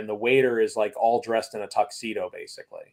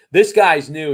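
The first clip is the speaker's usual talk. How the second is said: retold, half-raised announcing voice; playful mock call-out, a bit higher and louder; 5 semitones higher, 10 dB louder, slower than usual. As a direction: sing out the newcomer to the table. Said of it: "He goes".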